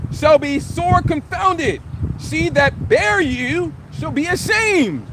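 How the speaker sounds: slow, solemn reading of the verse; scripture reading. Acted. Preacher reading scripture to the congregation, slow and solemn. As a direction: read the verse slowly and deliberately.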